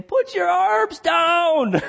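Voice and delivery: very high, slow, stretched-out mock scream; screaming coach. Holy Spirit urging a believer like a coach, mock screaming. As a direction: holler it like a coach on the sidelines. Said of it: "my coach was screaming on the sidelines".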